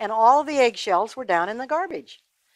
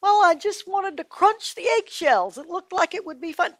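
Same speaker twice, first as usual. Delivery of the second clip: put-on a small boy plotting mischief; little boy Tommy; 9 semitones higher than usual. A narrator's reading. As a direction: wonder aloud like a mischievous child. Tommy explains to mama.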